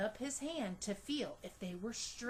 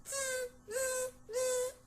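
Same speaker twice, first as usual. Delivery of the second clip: high squeaky monkey chatter; mock him like cheeky monkeys; 12 semitones higher, slower than usual. A narrator's reading. Monkeys mocking the peddler with high chattering. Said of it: "the monkeys ... said".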